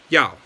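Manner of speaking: "Yao" with a sharp falling tone; fourth tone. Shown as a demonstration. Teacher showing learners the next character, "Yao" in fourth tone. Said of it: "fourth tone".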